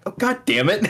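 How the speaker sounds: high-pitched exasperated outburst; retold high yelp of frustration; exasperated mock curse. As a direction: curse as if you've just been duped. Retold frustration at discovering the scam.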